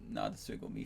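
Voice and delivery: usual speaking voice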